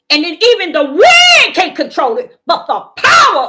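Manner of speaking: full-force shout to the congregation; 1 shouted preaching. Real, not acted. Preacher shouting about the Father's will to the church. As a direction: let the wind line ring out loud.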